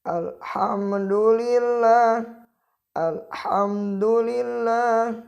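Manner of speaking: alhamdulillah recited twice with a clean mim; recitation demo. Shown as a demonstration. Teacher giving the example alhamdulillah. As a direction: intone alhamdulillah, no bounce on the mim.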